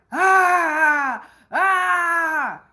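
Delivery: high, loud mock cry of amusement; hammed-up high shout, mimicking someone; mocking yelp. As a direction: imitate a loud, silly yelping "ah ah". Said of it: "he was mocking how Ty Connect sounded".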